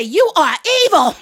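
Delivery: high, loud mock outrage; grandma's outraged accusation. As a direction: hurl it as a scandalised grandma. Grandma accuses child, acted.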